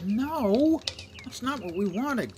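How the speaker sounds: sudden high frustrated yell; unhappy outburst. Real, not acted. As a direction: shout in real dismay. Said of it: "Pardon me as I make grunting, inarticulate, unhappy sounds".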